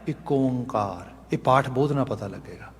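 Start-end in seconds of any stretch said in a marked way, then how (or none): 0.1-1.1 ਇੱਕ ਓਂਕਾਰ slow and softer, with a long nasal ਓਂ; correct recitation form. Shown as a demonstration. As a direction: join ਇੱਕ ਓਂਕਾਰ smoothly, drawing out the nasal o. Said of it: "ਪਰ ਅਸੀਂ ਉਚਾਰਨ ਕੀ ਕਰਦੇ ਹਾਂ?". (ਇੱਕ ਓਂਕਾਰ ਹੌਲੀ, ਲਮਕਾ ਕੇ, ਨਾਸਕੀ 'ਓਂ' ਨਾਲ ਉਚਾਰੋ)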